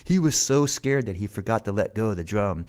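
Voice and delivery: ordinary delivery, nothing marked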